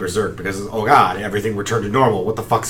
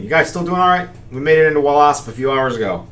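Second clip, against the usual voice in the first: muffled radio voice; 6 semitones higher, 4 dB louder than usual. Acted. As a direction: voice through a crackly stone radio. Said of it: "you hear a muffled Kandara voice saying".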